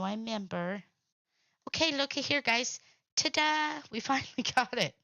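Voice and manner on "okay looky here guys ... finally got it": high, loud ta-da to the viewers; real excited announcement, pitched up; triumphant reveal. Real, not acted. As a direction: show it off with excited, raised pitch. Showing off the finished craft piece.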